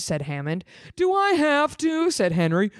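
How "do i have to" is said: high whiny "Do I have to?"; whiny kid brother. Acted. Henry balking at his brother's order, high and whiny. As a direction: whine it like a reluctant little brother.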